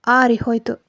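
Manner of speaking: ordinary delivery, nothing marked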